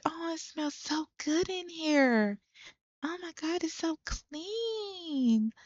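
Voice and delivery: acted high-pitched delight; gushing guest. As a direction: gush in a high voice, stretching the last word. Voicing a delighted Sim guest.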